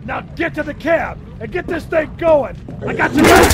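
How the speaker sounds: loud battle yell; shouting. Acted. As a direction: yell it across the street. Firefight, calling across.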